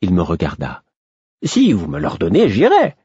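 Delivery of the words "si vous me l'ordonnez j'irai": voix haute qui monte encore; s'offrir au roi avec ardeur; fou empressé. A narrator's reading. Fool offers himself to his king. (élan dévoué, de plus en plus aigu)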